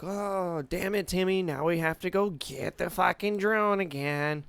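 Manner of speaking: put-on high, whiny scolding teen; alien teen voice. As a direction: play an annoyed alien kid.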